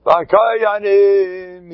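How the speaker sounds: the Chazon Ish's drawn-out ecstatic cry. Retold or imitated. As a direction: call it out in rapture. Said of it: "He went wild".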